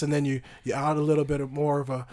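normal talking voice

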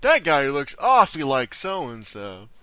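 slow, loud exaggerated remark; slow mock observer. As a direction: drawl it out like a gawking fan. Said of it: "It's just like".

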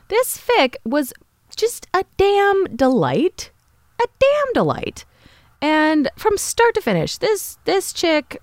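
host raving to listeners about a fic, squealing high; gushing delight. Real, not acted. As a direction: gush over it like it's the best thing ever.

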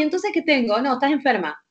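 ordinary talk

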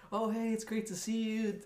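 bright high welcome voice; sweet high greeting. Retold or imitated.

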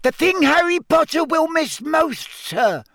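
high squeaky elf voice; squeaky house-elf. A narrator's reading. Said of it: "squeaked Dobby".

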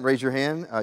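ordinary delivery

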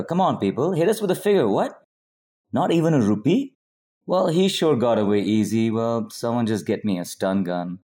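ordinary delivery

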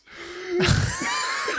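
high, loud burst of laughter. Real, not acted. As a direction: crack up helplessly. Cracking up at own joke.

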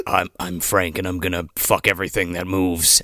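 low, soft menace-free deadpan; Frank impression. Mocking an actor's Frank, low and understated. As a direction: mimic a villain in a low, quiet voice.

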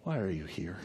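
very soft, low question; whisper-like hush. Acted. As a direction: ask it gently as God in the hush. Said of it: "And then there was a whisper".

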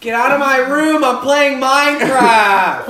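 high loud bratty yell; angry teen yelling. Pretend. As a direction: yell like a gamer kid at mom.

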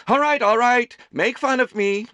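put-on mother chiding laughing boys, high and playful; high, loud playful chiding. A narrator's reading. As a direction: scold them for laughing, in fun. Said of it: "Amelie chided them".

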